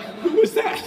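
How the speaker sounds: high, loud, laughing at himself; shocked at what came out of your mouth; laughing disbelief. Retold or imitated. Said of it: "and you're like".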